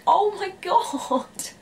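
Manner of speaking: high, long-drawn appalled exclamation; mock shock. Watching a friend insult an Irishman to his face, mock-appalled. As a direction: stretch it out high, acting out horrified disbelief.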